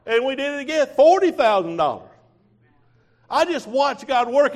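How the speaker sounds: rejoice as you say it; excited praise. Real, not acted. Preacher rejoicing to congregation over money, high and loud.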